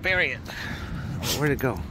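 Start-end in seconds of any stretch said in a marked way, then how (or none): none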